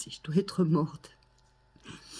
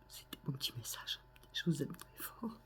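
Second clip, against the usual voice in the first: whispering; 12 dB quieter than usual. Real, not acted. Soft sign-off.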